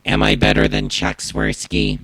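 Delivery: deep buzzy processed voice; Max Headroom voice. Shown as a demonstration. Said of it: "recreate the Max Headroom hijacker voice".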